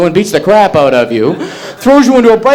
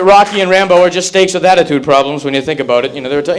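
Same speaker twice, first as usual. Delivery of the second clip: normal talking voice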